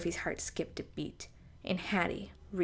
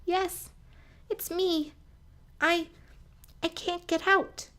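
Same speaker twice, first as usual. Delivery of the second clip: high, small squeaky voice, stammering; squeaked out; 11 semitones higher, 4 dB louder than usual. A narrator's reading. Trapped mouse squeaking up to the friend above. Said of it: "she squeaked out".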